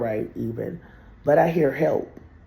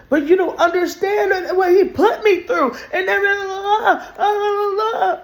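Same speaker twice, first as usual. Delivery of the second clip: tearful outburst; 8 dB louder than usual. Retold or imitated. Retold upset outburst.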